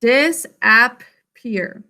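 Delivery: "Disappear" very slowly, DIS... AP... PEER; long gaps between the syllables of "Disappear"; sounded out. Shown as a demonstration. Teacher dictates as class writes, "Disappear" very slow by syllable. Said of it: "Sound it out".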